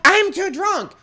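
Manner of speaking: high, loud mock whine; hammed-up drunken holler; drunk woman's protest. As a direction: imitate a drunk friend wailing. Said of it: "Doesn't she stomp in?".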